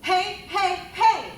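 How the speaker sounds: three loud, high heys; yelled call to copy. Shown as a demonstration. Teacher leading students with hands on tummies, loud high shouts. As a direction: punch out each hey for the class to echo. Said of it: "I want you to go".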